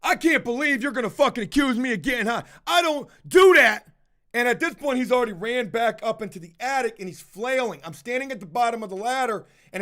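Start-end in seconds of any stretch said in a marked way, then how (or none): 0.0-3.9 loud, higher, outraged denial; indignant denial. Retold or imitated. Worker shouting his denial at the owner, loud. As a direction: protest the accusation loudly.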